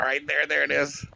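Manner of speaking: ordinary talk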